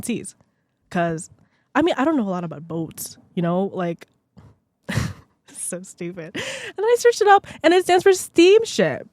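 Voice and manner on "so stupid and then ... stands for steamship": breaking up laughing, rising to a high squeal; helpless giggles getting louder and higher. Real, not acted. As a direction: squeal it through real laughter. Laughing at her own silly discovery.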